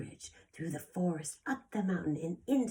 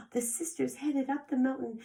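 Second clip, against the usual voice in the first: story narration; 8 semitones higher, 4 dB louder than usual. A narrator's reading. Reading story to children.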